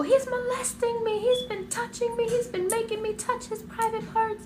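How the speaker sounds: very high, frantic outcry; reenacted screaming. Girl telling her aunt what uncle did, high frantic screaming. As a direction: blurt it out in a high, hysterical voice. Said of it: "I'm just screaming at the top of my lungs".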